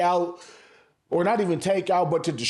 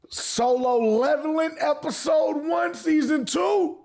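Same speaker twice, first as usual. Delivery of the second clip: hyped up; 9 semitones higher, slower than usual. Real, not acted. Hyping up an anime episode.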